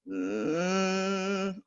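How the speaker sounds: long creaky mm rising into a hum; drawn-out creaky hum; fry into hum. Shown as a demonstration. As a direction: slide from vocal fry up into a held hum. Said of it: "like, a vocal fry underneath a hum".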